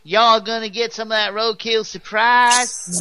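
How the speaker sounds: slow, high, twangy Southern drawl; Southern accent. Retold or imitated. Mocking the accent he denies, in Southern drawl. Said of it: "I don't have that accent".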